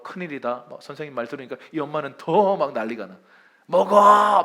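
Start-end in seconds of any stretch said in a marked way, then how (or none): 3.6-4.4 loud, high angry command to eat; 화난 엄마 imitation. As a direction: act out an exasperated mother yelling at her child. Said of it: "막 화를 내면서 막 먹여요". (화를 내며 크게 다그치는 목소리)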